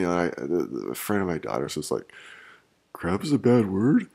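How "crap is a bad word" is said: loud, higher-pitched mock question; incredulous kid impression, voice raised; imitating a loud kid. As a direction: mimic a kid asking loudly, pitch rising at the end. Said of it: "she's saying it loudly in public".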